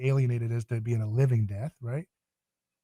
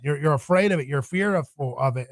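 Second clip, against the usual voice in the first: ordinary talk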